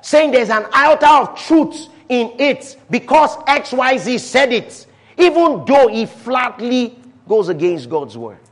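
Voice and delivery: loud, high, urgent sermon delivery; full-force preaching. Acted. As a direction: preach it hard from the pulpit.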